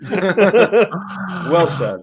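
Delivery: laughing through the words. Real, not acted. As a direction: repeat it while laughing.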